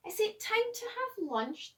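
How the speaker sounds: high, eager little voice; hungry question. A narrator's reading. The hungry dinosaur asking Danny about lunch, high-pitched. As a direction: ask it hopefully, high up.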